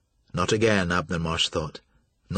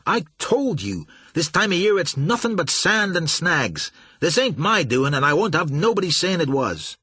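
defensive pilot; 7 semitones higher, 3 dB louder than usual. A narrator's reading. Pilot defending himself heatedly.

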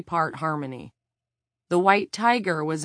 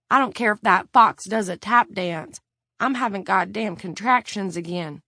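loud pained outburst; angry; 3 semitones higher, 6 dB louder than usual. A narrator's reading. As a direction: snap it out through contractions. Tiffany in labour, angry.

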